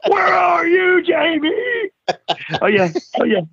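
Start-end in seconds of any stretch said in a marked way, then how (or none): 0.1-1.5 calling out desperately across the trailer park; mock desperate yell; hollering. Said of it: "he's hollering".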